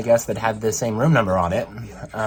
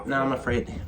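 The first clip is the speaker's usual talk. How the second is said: ordinary talk, nothing marked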